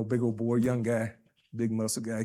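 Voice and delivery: ordinary talk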